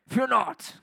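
a hint of a tough old Thor accent; mock Thor voice. Shown as a demonstration. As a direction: play the god of thunder, lightly. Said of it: "one of those tough old accents".

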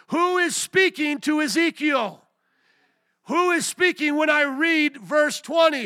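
high, booming pulpit shout; relentless shouted challenge to the congregation; preacher at full force. Real, not acted. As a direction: yell each question, then roar the answer. Preacher demanding, then shouting the answer.

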